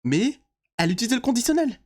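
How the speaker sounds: mocking voice. Mocking the gossip account.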